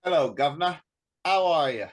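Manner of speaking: put-on British greeting, lightly done; British accent. Guest showing off a British accent on the host's request, mock British accent. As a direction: play at a chirpy British "guv'nor" accent. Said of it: "your best British accents".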